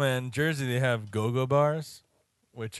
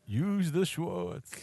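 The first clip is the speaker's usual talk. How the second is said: movie quote voice; 4 semitones higher, 5 dB quieter, much slower than usual. Pretend. Quoting Spaceballs line.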